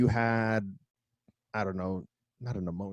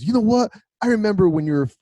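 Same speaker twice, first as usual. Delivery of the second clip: a bit higher and louder, indignant; mock pissed-off stepdad; 8 semitones higher, 6 dB louder, faster than usual. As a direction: play the stepdad snapping back, lightly voiced. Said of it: "my stepdad got pissed and goes".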